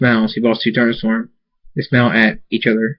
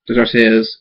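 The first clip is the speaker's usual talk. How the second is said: usual speaking voice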